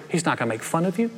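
ordinary talk, nothing marked

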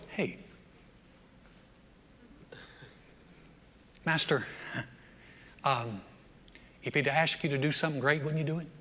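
soft, low, halting servant; tentative approach. Acted. Servant carefully approaching his upset master, soft and tentative. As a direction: broach it gently, with hesitant pauses. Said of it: "at least someone can approach us".

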